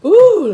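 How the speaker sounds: sharp high ooh from the heat; pained exclamation. Real, not acted. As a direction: yelp at the burn. Burning hot food reaction.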